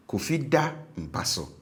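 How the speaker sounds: DA pitched high in "Kofi da mpa so". Shown as a demonstration. Teacher repeats the habitual tone, DA high. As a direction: lift DA clearly, drop after it. Teacher alternating the two tones.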